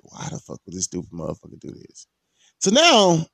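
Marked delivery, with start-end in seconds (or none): 0.0-2.1 low, very soft exasperated aside; hushed, exasperated grumble to yourself; muttered disbelief. Retold or imitated. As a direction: mutter it under your breath in disbelief. Muttered reaction to homie's stupid move.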